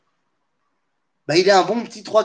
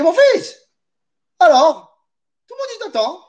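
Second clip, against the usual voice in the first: put-on very high mother with a Tunisian accent; 11 semitones higher, 4 dB louder than usual. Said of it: "avec un accent tunisien".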